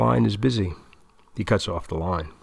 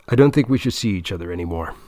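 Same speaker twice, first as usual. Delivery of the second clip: blurted out fast in one breath; rushed blurt; faster than usual. Acted. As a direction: get it out quickly, bracing. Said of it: "He takes a deep breath, closes his eyes, and dives in".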